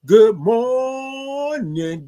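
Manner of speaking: very slow, high stretched "Good morning"; signature greeting. Shown as a demonstration. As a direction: hold "Good morning" out high like a call to the room. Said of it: "we like to say good morning".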